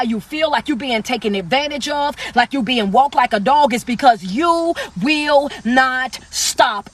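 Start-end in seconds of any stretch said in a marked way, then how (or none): 4.2-6.9 "you will not stop" at half pace, each word separate; emphatic word by word. Real, not acted. Host driving the point home to drained viewers, slow and emphatic.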